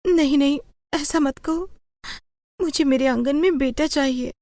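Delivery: high, pained cry of no; anguished refusal. Acted. Childless wife cries out to husband, anguished high plea. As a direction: she can't accept being childless.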